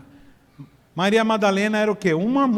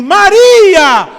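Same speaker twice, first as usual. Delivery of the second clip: much higher, much louder shout of the name; calling out; 13 semitones higher, 16 dB louder than usual. Acted. Jesus calls to Mary with her back turned, loud shout. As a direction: cry her name across the garden. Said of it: "ele fala assim".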